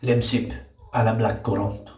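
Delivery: a hammed-up bad French accent; a light put-on French accent on a drink name; mock French accent. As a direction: play it fancy and French, badly. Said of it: "that was meant to be French".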